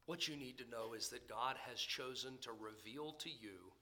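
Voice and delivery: usual speaking voice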